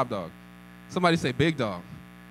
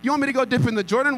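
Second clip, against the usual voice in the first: higher, louder and fast, outraged rise; indignant retort; 6 semitones higher, 4 dB louder, faster than usual. Acted. Naaman outraged at dipping in the Jordan, louder and faster. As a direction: hurl it back in disbelief. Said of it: "went off in anger".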